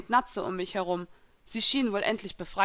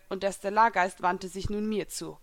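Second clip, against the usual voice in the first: usual speaking voice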